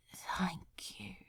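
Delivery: breathless whispered thanks; barely voiced, a soft whisper; hushed grateful whisper. A narrator's reading. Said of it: "he whispered".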